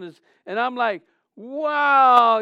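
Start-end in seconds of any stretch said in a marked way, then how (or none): 1.4-2.4 loud, high shout of wow; stunned wow at the lightning; awed wow. Retold or imitated. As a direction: gasp out a big wow in awe. Awe at green lightning.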